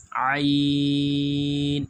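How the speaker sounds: "عین" squeezed from mid-throat, very drawn out. Shown as a demonstration. Teacher asking students to practise, long throaty ain. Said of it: "یہ ہمیں دوستو حلق میں سے نکالنی ہے".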